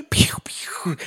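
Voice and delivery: airy imitated pistol shots; toy-gun pops with the mouth; little six-shooter noises. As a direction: puff out two mock gunshots. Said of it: "with his little six shooters".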